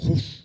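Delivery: voiceless rush of breath; imitated whoosh. Pastor mimics the whole restaurant's reaction, breathy whoosh. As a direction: blow out a whoosh for a stunned room. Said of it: "the whole restaurant, like".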